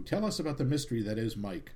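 ordinary talk

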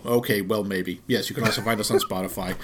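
quickly and quietly give in; sheepish. Real, not acted. Backs down after shouting no.

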